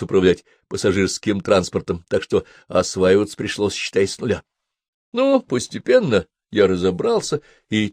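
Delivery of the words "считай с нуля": ordinary delivery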